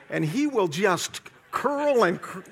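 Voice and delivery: ordinary talk, nothing marked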